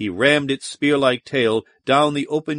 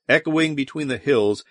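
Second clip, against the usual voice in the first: ordinary delivery, nothing marked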